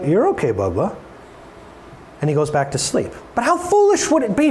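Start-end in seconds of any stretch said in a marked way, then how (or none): 0.0-1.0 soothing dad. Retold or imitated. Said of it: "and I'm like".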